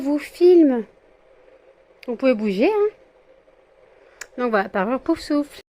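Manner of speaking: loud, high chatter to the pets; excited pet talk. Real, not acted. Owner calling to her posing pets, loud high excited chatter. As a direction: call to the pets brightly.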